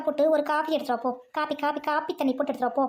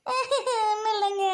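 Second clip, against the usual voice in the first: high, slow whimpering denial; hammed-up tearful meekness; meek whimper; 5 semitones higher, much slower than usual. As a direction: squeak it out timidly, drawn out. Caught complaining, wife denies it meekly.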